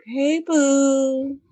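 high, sugary bait voice; mock-innocent girlfriend charm; fake sweet. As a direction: lure him in sweetly. Fake-sweet call to cheating boyfriend.

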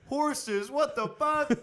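very high, loud cry of disbelief; hammed-up panicked swearing; mock outrage. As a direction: yelp it in comic fury. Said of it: "He just gets pissed".

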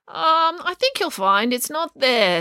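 drawn-out, knowing hesitation; hesitant great-aunt. Acted. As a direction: break it to them slowly. Aunt hesitantly breaks news.

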